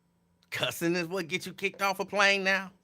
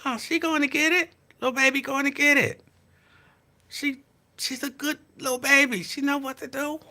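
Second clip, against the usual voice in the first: fond high talk; 9 semitones higher than usual. Real, not acted. To viewers about the little girl, doting. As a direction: praise her like a proud aunt.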